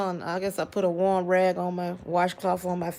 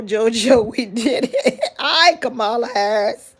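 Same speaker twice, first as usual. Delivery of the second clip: laughing; 2 semitones higher, 3 dB louder than usual. Real, not acted. Laughs reading a comment.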